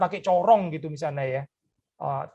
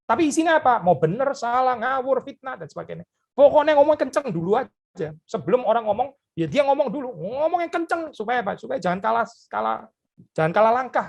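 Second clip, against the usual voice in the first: higher and louder, a mock pushy blusterer; mock loud talker; 7 semitones higher than usual. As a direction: ham up someone who talks over everyone. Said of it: "Orang-orang yang ngomong kenceng".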